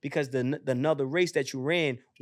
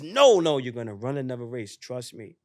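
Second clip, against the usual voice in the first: reassuring divine voice; 5 semitones lower than usual. Retold or imitated. God tells the runner, low calm reassurance. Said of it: "you hear this voice".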